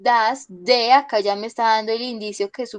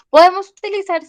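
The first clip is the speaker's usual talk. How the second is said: emphatic; 8 semitones higher, 10 dB louder, faster than usual. Real, not acted. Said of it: "teacher stresses a key point".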